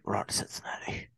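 whispered coach catchphrase; whispered quote. Retold or imitated. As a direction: whisper it reverently. Whispering it again.

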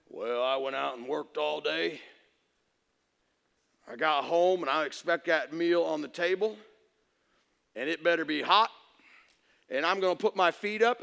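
a hint of a self-satisfied husband who expects service; lazy demanding husband.

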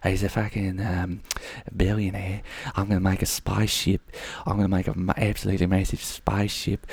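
lightly voiced billionaire with a New Zealand accent; attempted New Zealand accent. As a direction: boast quietly in a shaky attempt at New Zealand. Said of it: "No, New Zealand's like".

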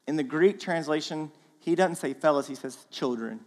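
usual speaking voice

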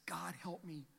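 breathy whispered "God, help me"; whispered prayer. Acted. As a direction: barely breathe the plea.

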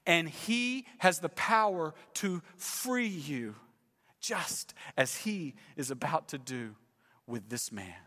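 ordinary delivery

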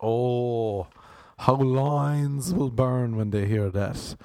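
ordinary talk

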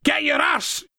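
high-pitched police shout. A narrator's reading. Officer shoving past to arrest, shouting. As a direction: yell it as an urgent police order.

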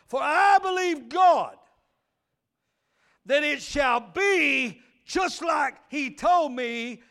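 loud, slow, drawn-out preaching; preaching at full force. Acted. Preacher reading scripture to the church, loud and drawn-out. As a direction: stretch every word like a sermon peak.

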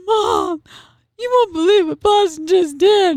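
put-on high whiny wail of a tattling kid; mock bawling child. Mom acts out her crying son running in, mock bawling. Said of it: "Tucker comes in just bawling".

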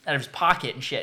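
ordinary talk

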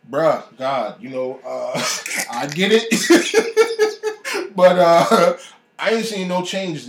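high, loud exasperated laugh at God; laughing plea. Retold or imitated. Telling God he gets it but sees no change, laughing loudly. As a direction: laugh it out, half pleading, half joking.

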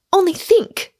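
high-pitched, urging sister's voice; protesting older sister. A narrator's reading. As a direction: urge her to think, pitch lifted high.

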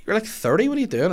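high, loud mock telling-off; imitated scolding. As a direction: scold him as if he should know better. Retold scolding of a grown man.

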